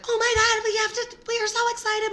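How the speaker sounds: high-pitched gushing; retelling a giddy high-pitched rush; excited squeal. Retold or imitated.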